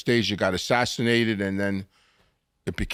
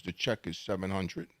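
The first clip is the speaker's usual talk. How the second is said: low and much softer, dropping the bad news; hushed bad news; 4 semitones lower, 12 dB quieter than usual. Buddy tells his friend about the huge check, hushed. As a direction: break it quietly. Buddy quietly reveals the bill.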